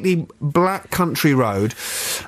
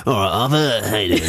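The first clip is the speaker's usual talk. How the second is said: lightly mimicked barman greeting, dropping low at the end; matey TV barman impersonation; Dave the barman; 5 semitones lower than usual. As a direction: do a rough impression of a pub barman. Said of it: "Dave the barman".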